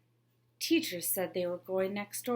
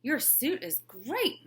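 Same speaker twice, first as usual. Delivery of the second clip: slow, louder bluff; forced praise; 6 semitones higher, 4 dB louder than usual. Acted. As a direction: oversell it nervously. She bluffs to the principal.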